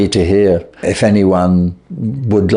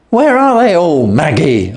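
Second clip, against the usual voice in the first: cried out; 7 semitones higher than usual. A narrator's reading. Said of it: "I cried".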